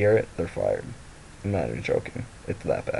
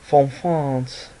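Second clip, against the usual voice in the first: louder, higher put-on French accent; mock French accent; 5 semitones higher, 5 dB louder than usual. Shown as a demonstration. Repeating where the gift came from, mock French accent.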